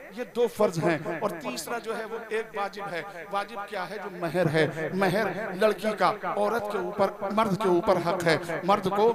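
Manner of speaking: loud, driving sermon voice; full-force preaching. Acted. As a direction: preach it out to the whole gathering. Preacher lecturing loudly on marriage. (ज़ोरदार तक़रीर का अंदाज़)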